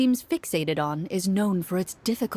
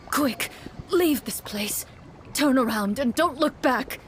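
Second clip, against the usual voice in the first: wounded guards yelling; 5 semitones higher than usual. Acted. Wounded guards warn the party away, mild yell. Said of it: "they yell".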